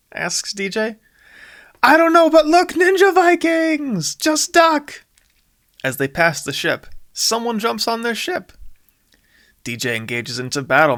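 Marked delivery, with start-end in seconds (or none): 1.8-5.0 high, loud alarm; panicked shout. Acted. Jack warns D J as the enemy ship passes, shouting. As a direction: yell a warning to duck.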